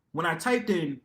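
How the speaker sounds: ordinary talk, nothing marked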